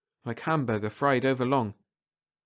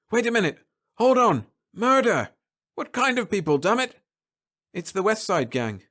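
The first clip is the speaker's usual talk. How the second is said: higher, louder urgent orders; alarmed captain shouting; 5 semitones higher, 4 dB louder than usual. Acted. Captain warning Holloway about Martians, urgent shout. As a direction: yell at him to get out.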